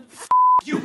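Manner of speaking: very high, loud, cartoonish yell; mock shouted insult. Putin's note to Gaza, a very high, loud mock yell. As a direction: bark it like a spiteful villain.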